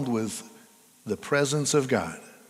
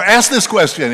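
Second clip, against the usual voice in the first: higher, louder but reined-in call; firm command; 7 semitones higher, 10 dB louder than usual. Acted. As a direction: say it firmly, a notch down. Same call, less extreme.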